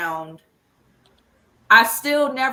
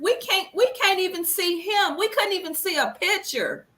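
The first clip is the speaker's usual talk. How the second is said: higher, pleading exasperation; reliving the disbelief, pitched up; perturbed, exasperated; 8 semitones higher than usual. Retold or imitated. Mother retelling to her husband how they could not see him, exasperated and higher. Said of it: "I was perturbed by it".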